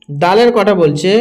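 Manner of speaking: ordinary talk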